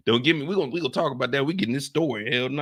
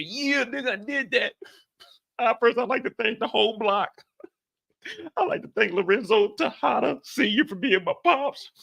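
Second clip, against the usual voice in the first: lightly voiced award speech; mock acceptance speech. Host imagines Drew's championship thank-you speech, mock solemn. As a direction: thank everyone like on a stage. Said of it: "He over here".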